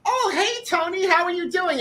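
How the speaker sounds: very high, put-on greeting voice; friendly greeter. Handsome man greets the nervous contestant, friendly imitation. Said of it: "this very handsome man comes up to me and is like".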